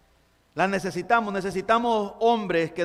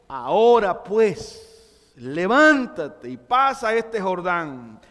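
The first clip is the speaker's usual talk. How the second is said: very slow, louder, firm order; divine command; 4 dB louder, much slower than usual. Pretend. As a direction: command it slowly, no room for doubt. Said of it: "es una orden".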